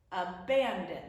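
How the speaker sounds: abandon with BAN stressed, ending on a fall; stress on middle syllable. Shown as a demonstration. Teacher giving the right accent, middle syllable of abandon stressed. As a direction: put the weight on BAN in abandon. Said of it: "That's what the accent is".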